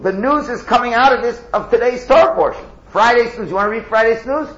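high, loud fervent preaching; preaching at full force. Real, not acted. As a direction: build to a loud, high sermon cry.

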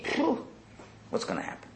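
an anxious, barely voiced aside to himself; dread-filled whisper. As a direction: breathe out, then whisper the fear. Avram sighs in dread.

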